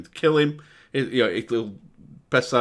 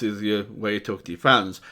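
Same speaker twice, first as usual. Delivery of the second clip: normal talking voice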